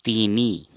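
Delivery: तीनी loud, as two syllables ti-ni; Assamese pronunciation. Shown as a demonstration. Teacher showing the Assamese three, loud clear ti-ni. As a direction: say तीनी with a sounded final i. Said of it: "असमिया में".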